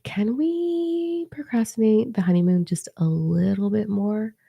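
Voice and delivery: pleading sweetly. Retold or imitated. Asking her future husband to delay the honeymoon, sweet high plea. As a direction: wheedle it like asking a favour.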